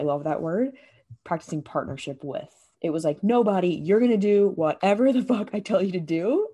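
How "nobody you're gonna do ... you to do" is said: loud, domineering command to her own body; mock tyrant yelling at her body; bossy inner voice. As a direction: bark orders at yourself like a drill sergeant. Harsh inner voice bossing her body.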